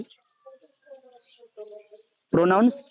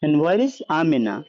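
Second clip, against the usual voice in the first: slow translation; much slower than usual. Shown as a demonstration. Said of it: "ওয়া আইনা আমিনা".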